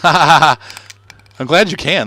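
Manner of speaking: laughing delight. Real, not acted. Laughing pleased at paint.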